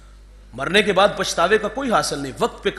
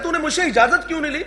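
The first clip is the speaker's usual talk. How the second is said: much higher, fast, laughing mockery; mocking imitation; 10 semitones higher, faster than usual. Preacher mocking the proud congregant's complaint, high and laughing. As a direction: mimic the petty complaint with a laugh. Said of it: "ہم کہتے ہیں".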